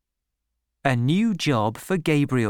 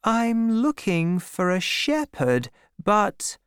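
higher-pitched woman character, trailing into "but"; hesitant lady farmer; 8 semitones higher than usual. A narrator's reading. Bathsheba hesitating over hiring Gabriel.

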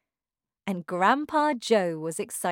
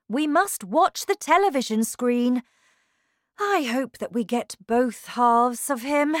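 raised, emphatic showman voice; animated Mr. Wonka; 6 semitones higher, 6 dB louder than usual. A narrator's reading. Wonka directing parents to the television screen, animated and urgent. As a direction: speak up with lively urgency. Wonka urges them to watch.

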